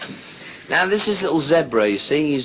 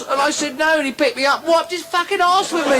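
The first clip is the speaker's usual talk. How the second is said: outraged punchline; 14 semitones higher than usual. Retold or imitated. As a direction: yell the punchline in mock outrage.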